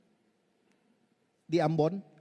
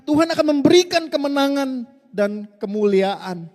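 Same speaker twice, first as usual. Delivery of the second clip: loud, higher sermon delivery; full-force preaching; 3 semitones higher, 9 dB louder than usual. Real, not acted. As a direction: preach it out to the hall. Preaching to the congregation. (khotbah penuh semangat, keras)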